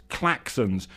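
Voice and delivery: usual speaking voice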